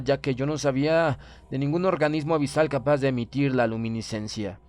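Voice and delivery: usual speaking voice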